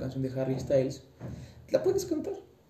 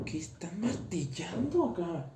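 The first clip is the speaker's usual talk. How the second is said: whispered, half to herself; muttered whisper about the noise; whispered aside; 5 semitones higher than usual. Real, not acted. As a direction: whisper the complaint under your breath.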